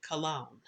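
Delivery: "Cologne" said kuh-LOHN, stressed on the end; family's pronunciation. Shown as a demonstration. Host relaying the family's way, "Cologne" said kuh-LOHN. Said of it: "they pronounce it".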